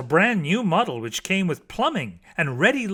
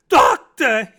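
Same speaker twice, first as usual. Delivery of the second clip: high, louder plaintive call; lamenting; 6 semitones higher, 9 dB louder than usual. A narrator's reading. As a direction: call out to the doctor in a wail. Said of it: "he lamented".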